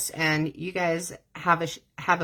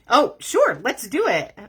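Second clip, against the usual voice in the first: eager agreement; 5 semitones higher, 5 dB louder than usual. Retold or imitated. Imitating Jeremy's eager agreement.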